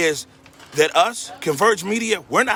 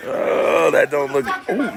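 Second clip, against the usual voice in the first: ordinary delivery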